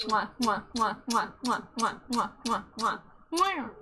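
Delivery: repeated smooching kisses; kiss sounds. Pretend. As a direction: blow a string of kisses. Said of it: "We got Smooch the Wife ten pack".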